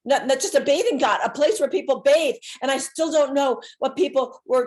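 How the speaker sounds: loud, pressing, breathless telling; breathless urgency. Real, not acted. Reliving being crushed in the temple crowd, loud and urgent. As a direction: push the words as if squeezed by the crowd.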